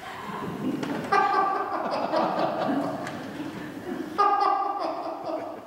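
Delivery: high breathy demonstration laugh; demo laugh. Shown as a demonstration. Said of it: "자연스럽게 웃게 돼요". (웃음을 시범 보이듯 높은 숨소리 웃음)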